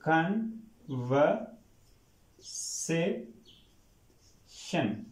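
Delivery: "Conversation" very slowly, four separated syllables, r dropped. Shown as a demonstration. Teacher shows students how to read it, syllable by syllable. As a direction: draw out "conversation" as kan, vuh, say, shun. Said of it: "यानी यहाँ पे पढ़ने में इसको".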